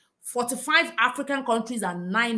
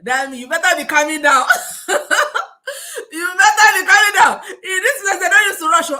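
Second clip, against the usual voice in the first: higher and louder, laughing through it; laughing friend; 6 semitones higher, 9 dB louder than usual. Retold or imitated. Friend Miss B telling her to calm down, laughing. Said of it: "She was like".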